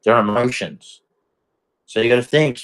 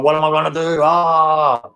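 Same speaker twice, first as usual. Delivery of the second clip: raised, cornered cry, acted out; mock panic; 7 semitones higher than usual. Man acts someone getting cornered, mock panic. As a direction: pitch it up into a panicked wail.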